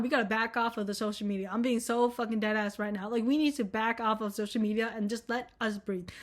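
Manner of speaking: ordinary delivery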